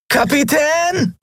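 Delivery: a high, drawn-out call into the forest; shouting as loud as he can. Acted. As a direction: yell for the captain with all your strength. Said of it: "rief er so laut er konnte".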